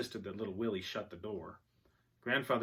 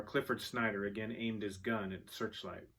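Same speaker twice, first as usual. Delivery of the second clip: usual speaking voice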